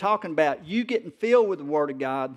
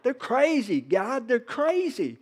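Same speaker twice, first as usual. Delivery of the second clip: higher, whiny "They're crazy, God, they're crazy"; exasperated complaint; 8 semitones higher than usual. Retold or imitated. Pastor imitates himself griping to God, exasperated. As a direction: complain to God like a fed-up kid. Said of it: "I'll be like".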